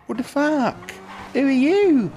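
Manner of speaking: loud, very high cry of alarm; startled outburst. Real, not acted. Kidnapped player confronts the stranger, startled shout.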